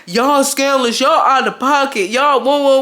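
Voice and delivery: high, loud retold outcry; hammed-up shocked scolding; mock scolding. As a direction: call them out from the driver's seat. Acted cousin scolding the laughing passengers.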